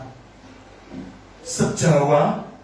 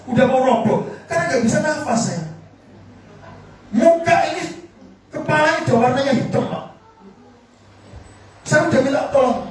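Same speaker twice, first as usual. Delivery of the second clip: urgent panicked retell; 7 semitones higher, faster than usual. Retold or imitated. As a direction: rush it like he is running out of air. Near collapse, can't breathe. (panik, cepat, suara naik)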